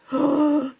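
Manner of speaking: a loud, high gasp of amazement; delighted gasp. Real, not acted. Vlogger gasping at how the lash bath feels, amazed gasp. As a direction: gasp as if lost for words.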